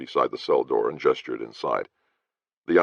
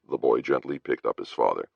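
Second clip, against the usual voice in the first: normal talking voice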